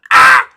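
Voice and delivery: sudden piercing high shriek; startled shriek. Real, not acted. Streamer caught off guard, shrieking. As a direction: yelp out in shock.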